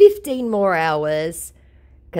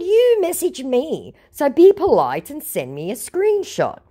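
scolding; 12 semitones higher than usual. Retold or imitated. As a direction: tell him off like a stern teacher. Scolding the scammer.

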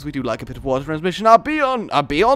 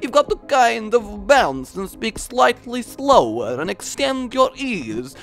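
put-on Transylvanian accent; 7 semitones higher, 3 dB louder than usual. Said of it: "That's accent corner".

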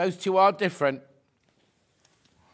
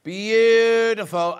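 drawn-out admiring; 4 semitones higher, 4 dB louder, much slower than usual. Real, not acted. Long drawn-out admiring word.

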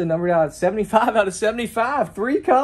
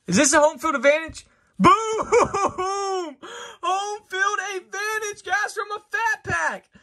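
excited shout; 10 semitones higher than usual. Real, not acted. Pulling a rare card from a pack, excited shout. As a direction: yell it out, thrilled and laughing. Pulls a case hit.

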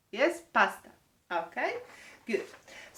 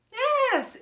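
"Yes" soaring high, louder; delighted praise; 5 dB louder than usual. Real, not acted. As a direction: cheer the right guess. Kids guessed right.

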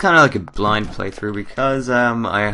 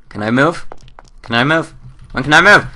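growing impatience; 6 semitones higher, 6 dB louder than usual. Real, not acted. Impatient, rising each repeat.

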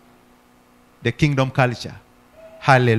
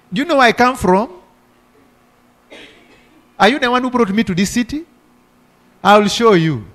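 higher, indignant mock rant; angry retort; 6 semitones higher than usual. Believer scolding the neighbour who dumped trash, hammed-up outrage. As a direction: snap back like an offended hothead. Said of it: "if you came out and said".

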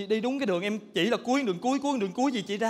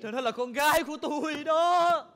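slow shocked reveal; 6 semitones higher, 3 dB louder, slower than usual. Acted. Mother reveals girl is hers.

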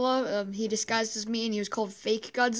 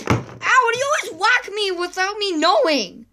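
higher, louder whiny protest; hurt complaint; 7 semitones higher, 6 dB louder than usual. Acted. Godzilla whining at Kong for whacking him, higher and louder. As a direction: whine about getting hit.

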